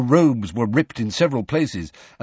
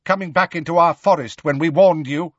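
angry centaur, raised pitch; accusing, louder; 8 semitones higher, 5 dB louder than usual. Acted. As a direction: accuse him sharply. Bane accuses Hagrid.

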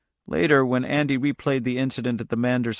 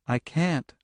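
put-on soft, detached girl's voice; calm; 3 semitones higher, 5 dB quieter than usual. A narrator's reading. Charlie to her shouting father, calm and soft. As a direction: answer quietly, unbothered. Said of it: "She said in that same calm voice".

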